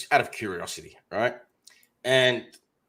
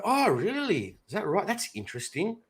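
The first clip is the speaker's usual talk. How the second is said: mock interest; 8 semitones higher than usual. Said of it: "I go".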